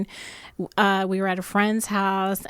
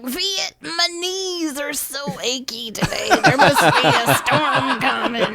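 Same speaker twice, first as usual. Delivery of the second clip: mock old lady; 5 semitones higher, 4 dB louder than usual. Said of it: "And I'm like".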